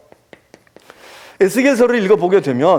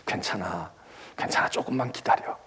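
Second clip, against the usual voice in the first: whispered comfort, softer; whispered 조금만 기다려 as gentle consolation; 8 semitones lower, 11 dB quieter than usual. Acted. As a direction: breathe the reassurance to someone worn out. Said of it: "감싸주고 희망을 심어주고".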